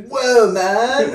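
high, loud caricature of American speech; mock American English. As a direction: laughingly exaggerate an American accent. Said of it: "what they think we sound like in English".

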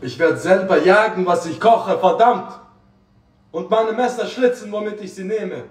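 loud, high fired-up rant; excited outburst. Real, not acted. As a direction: hype yourself up, shouting. Aufgeregter Ausbruch beim Kochstream. (aufgeregt und laut)

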